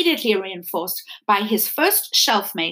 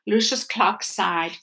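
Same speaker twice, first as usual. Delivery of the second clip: usual speaking voice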